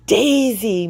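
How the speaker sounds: high, loud cry of her hen's name; a glad, ringing call; joyful shout. A narrator's reading. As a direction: shout the name in sudden relief. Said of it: "May May shouted".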